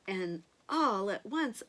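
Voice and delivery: usual speaking voice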